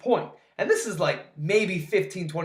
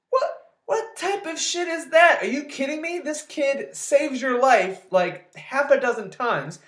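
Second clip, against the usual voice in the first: outraged rant; 5 semitones higher than usual. Real, not acted. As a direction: vent real outrage at a useless hero. Outraged rant at the movie character.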